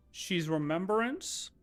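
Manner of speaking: ordinary talk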